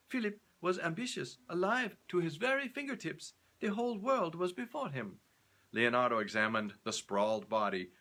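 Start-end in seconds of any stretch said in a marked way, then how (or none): 0.1-5.2 high, proud noblewoman; the Countess. Acted. Countess defends Philip to Leonardo, raised insistent voice.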